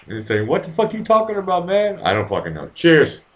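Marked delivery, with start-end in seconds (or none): 0.5-2.0 high exasperated mock sister voice; mock big sister whining; imitating older sister. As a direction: play the annoyed older sister, pitched up. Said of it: "you're gonna sound like my older sister".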